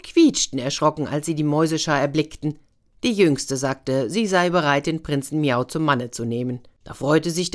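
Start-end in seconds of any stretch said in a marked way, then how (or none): none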